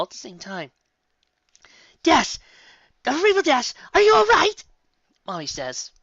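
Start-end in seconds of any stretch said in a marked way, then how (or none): 2.0-4.6 high, loud, alarmed mother; worried shout. Acted. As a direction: cry out in urgent worry. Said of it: "in a worried voice".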